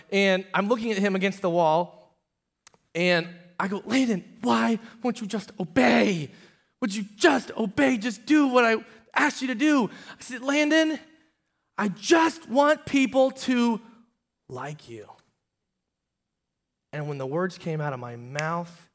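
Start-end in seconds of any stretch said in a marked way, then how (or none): 3.8-9.9 loud, high parental outburst, re-enacted; exasperated pleading. Father at wits' end pleading. 10.4-13.8 very high, loud, drawn-out plea; desperate outburst. Retold or imitated. Father's outburst, about to cry. 14.5-15.1 sudden drop to a low, soft voice; deflated hush. Retold or imitated. Outburst collapses into sudden realization.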